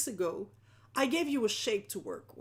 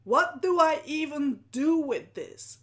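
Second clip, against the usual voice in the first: loud, slow, pitched-up bewilderment; completely at a loss; 5 semitones higher, 10 dB louder than usual. Acted. Viewer stuck on the art prompt, at a loss. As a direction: act out a stumped participant, hammed up. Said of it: "who are completely at a loss".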